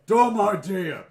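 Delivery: loud, slow, blunt monster bark; gruff head shout. Acted. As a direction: bellow it like a dim brute. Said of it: "Says the other head".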